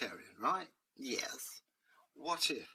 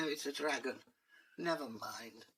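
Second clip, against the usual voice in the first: ordinary delivery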